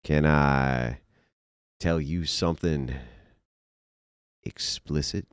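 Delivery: drawn out with long pauses; mock wooing. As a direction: let each phrase hang, teasingly suggestive. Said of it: "Watch as I woo the women".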